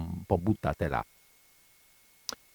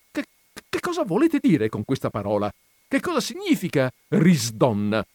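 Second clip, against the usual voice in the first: higher, exasperated questioning; baffled demand; 6 semitones higher, 3 dB louder than usual. A narrator's reading. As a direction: demand to know the meaning. Baffled widow demands explanation. (domanda sconcertata)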